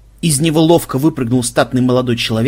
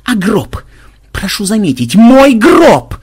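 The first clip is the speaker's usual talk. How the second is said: high, building to a loud shout; indignant outburst; 8 semitones higher, 7 dB louder, slower than usual. A narrator's reading. Ксенобайт corrects them about his coffin, building to a furious cry. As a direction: climax in wounded outrage. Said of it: "взорвался Ксенобайт".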